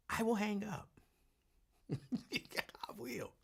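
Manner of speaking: soft, laughing half-whisper; a soft laughing whisper to the listeners. Real, not acted. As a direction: giggle the promise under your breath. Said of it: "laughing aside".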